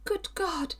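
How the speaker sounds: high, startled cry of alarm; shocked exclamation. A narrator's reading. Captive woman, hearing the threat, in high alarm. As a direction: gasp it out in horror. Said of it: "she exclaimed".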